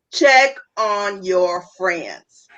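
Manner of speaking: slowed right down, each word weighted; deliberate slow restatement; slow emphatic repeat. Shown as a demonstration. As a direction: spell it out slowly so it sinks in.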